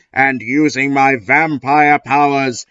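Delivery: ordinary delivery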